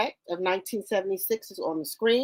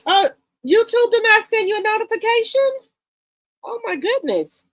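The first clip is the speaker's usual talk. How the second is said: surprised exclaim; 12 semitones higher, 5 dB louder than usual. Real, not acted. Viewer missed notification.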